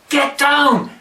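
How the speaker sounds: sharp high yell of a bodyguard; urgent mock yell to duck; yelled command. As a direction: bark it like a Secret Service agent. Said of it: "isn't allowed to yell".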